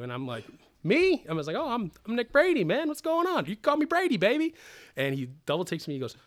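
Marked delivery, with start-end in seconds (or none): 0.8-1.2 very high, loud squeak of disbelief; hammed-up high startled question; starstruck. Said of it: "I was still blown away by everything". 1.6-4.5 hammed-up, very high, gushing introduction; comic overeager fan pitched way up; starstruck. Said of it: "I was still blown away by everything".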